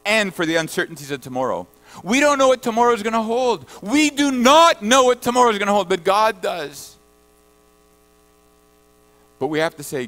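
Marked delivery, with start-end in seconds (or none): 2.0-7.0 louder, higher preacher's build; fervent preacher climbing in pitch and volume; rising preaching. Acted. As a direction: drive it home from the pulpit. Preacher's climax on tomorrow.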